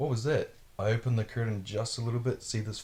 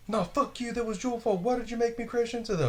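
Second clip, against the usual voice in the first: high, whiny angry driver voice; mocking the angry driver; 13 semitones higher than usual. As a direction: mimic a furious idiot passing blame. Angry crashed driver blames him.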